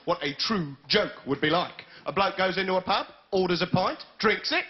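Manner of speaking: normal talking voice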